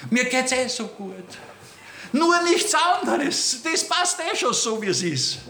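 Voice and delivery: a light parody of comfortable piety; smug complacency. Pretend. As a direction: play the content believer who wants no change. Mimics a smug, complacent believer.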